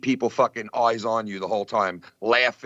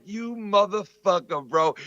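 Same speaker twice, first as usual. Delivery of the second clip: imitating the crowd; 6 semitones higher, slower than usual. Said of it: "the crowd was like".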